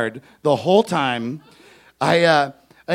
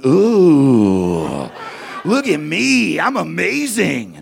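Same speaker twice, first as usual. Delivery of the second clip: high, loud, gloating heckler voice; cocky kid showing off; 3 semitones higher, 4 dB louder, slower than usual. Retold or imitated. Kid heckler gloats at the crowd, loud and smug. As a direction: strut it like a smug little kid. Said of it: "just going".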